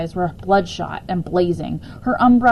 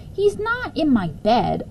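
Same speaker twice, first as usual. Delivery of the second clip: put-on higher, slow, light boy voice; objecting boy; 5 semitones higher than usual. Boy objecting to his mother, lightly voiced. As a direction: protest mildly to mother. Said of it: "objected Cal".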